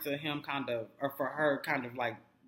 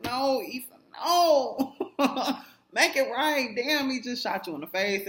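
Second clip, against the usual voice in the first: pleading outcry; 11 semitones higher, 7 dB louder, slower than usual. Real, not acted. Pleading with character on screen.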